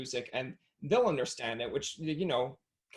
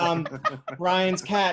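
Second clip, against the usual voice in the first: mock confused caller, louder and higher; hesitate, then repeat it in mock bafflement; 4 semitones higher, 7 dB louder, slower than usual. Host imitates a caller confused by a talking cat, puzzled. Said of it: "if they're confused".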